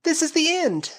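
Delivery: high, loud cry of despair. A narrator's reading. Flora sure she will die, loud despairing cry. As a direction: wail it as if all is lost.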